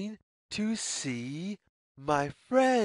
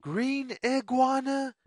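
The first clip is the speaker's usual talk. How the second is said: sing-song call; 6 semitones higher, faster than usual. A narrator's reading. Calling out to the iguana.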